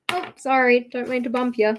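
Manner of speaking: usual speaking voice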